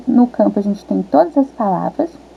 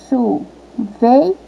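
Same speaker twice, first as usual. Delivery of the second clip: "Chuveiro" in three slow, detached syllables; separação silábica; much slower than usual. Shown as a demonstration. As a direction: break "Chuveiro" into chu, vei, ro. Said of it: "continua a palavra". ("Chuveiro" devagar, com pausa entre sílabas)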